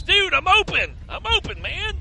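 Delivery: high, loud call for the ball; excited shout. Retold or imitated. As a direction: yell like an open receiver. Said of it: "was waving his arms all over the place".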